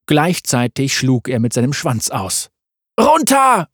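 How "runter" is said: sharp high loud yell; shouted warning. A narrator's reading. Corvus warns his father of the tail, shouting. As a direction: bark it to save his father. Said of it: "rief Corvus".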